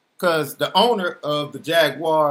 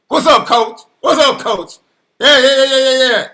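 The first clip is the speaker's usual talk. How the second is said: louder, higher, over-keen phone greeting; eager acted greeting; 7 semitones higher, 7 dB louder than usual. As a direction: jump on the call with rapid, repeated enthusiasm. Acted eager phone call from coach.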